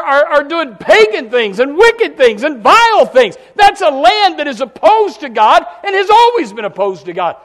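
loud, high, hammering sermon climax; rising shout from the pulpit; full-force preaching. Real, not acted. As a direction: preach it hard to the crowd.

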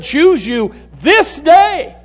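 slow, high-pitched scripture proclamation; ringing proclamation. Retold or imitated. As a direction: proclaim it slowly and loudly to the congregation. Scripture quote proclaimed to congregation.

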